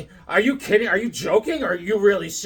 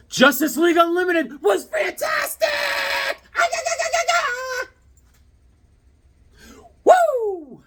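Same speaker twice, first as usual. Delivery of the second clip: Ric Flair. Acted. Vlogger hypes a poll result as a wrestler, shouting high. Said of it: "Little Ric Flair on this one".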